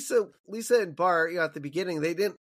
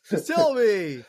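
mild mock yell; a half-hearted retold yell; 3 semitones higher, 5 dB louder, slower than usual. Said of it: "Roger yelling at him".

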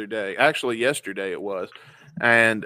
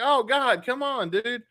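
much higher, groaning fed-up complaint; exasperated; 11 semitones higher than usual. Retold or imitated. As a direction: react like you can't believe it again. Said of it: "I'm like".

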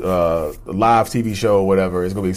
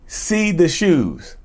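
see drawn out very slowly and pitched higher; stress on see; 7 semitones higher, much slower than usual. Real, not acted. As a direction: lean hard on see, slow right down. Explaining cognitive empathy by example.

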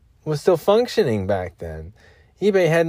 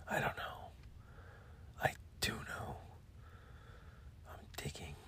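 softer ad whisper; 6 semitones lower, 23 dB quieter than usual. Pretend. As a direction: whisper it into the mic. Whispered ad lines.

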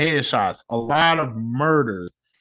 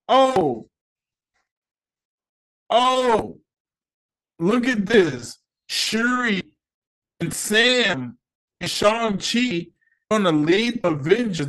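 higher, hammed-up fake excitement; mocking the joke; 5 semitones higher, slower than usual. Mocking fans who repeat the lame Avengers joke, fake excited. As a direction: mimic fans gushing over the lineup. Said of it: "Everybody making the same lame ass joke".

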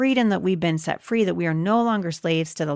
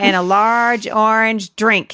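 slow, put-on ordering voice; drive-thru order; 4 dB louder, much slower than usual. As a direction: drawl it like a fast-food order.

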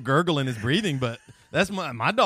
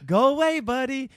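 high, sing-song mock friendliness to a dog; sweet mock coaxing; 10 semitones higher, 5 dB louder than usual. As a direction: coo it up high like talking to a puppy. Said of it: "So you went from".

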